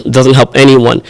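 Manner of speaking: ordinary talk, nothing marked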